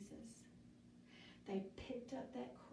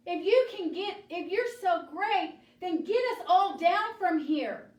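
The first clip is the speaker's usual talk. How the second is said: high, loud challenge from a desperate robber; taunting shout; 9 semitones higher, 13 dB louder than usual. Acted. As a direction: yell it as an angry demand. Crucified robber taunts Jesus.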